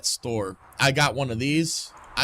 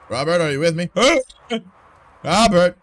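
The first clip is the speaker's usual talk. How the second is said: urgent calling; 4 semitones higher, 8 dB louder than usual. Acted. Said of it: "Getting into character".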